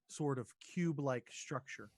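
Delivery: ordinary talk, nothing marked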